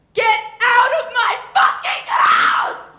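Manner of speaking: high, slow, very loud screaming parent; furious shout. Pretend. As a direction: scream it like an enraged mom throwing you out. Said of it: "here's an impression".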